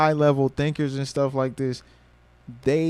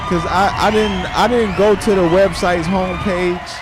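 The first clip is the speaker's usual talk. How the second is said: loud, high, thrilled thanks; shouting with joy at the news; excited shout; 5 semitones higher, 4 dB louder than usual. Real, not acted.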